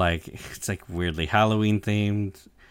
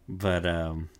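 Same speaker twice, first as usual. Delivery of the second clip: ordinary talk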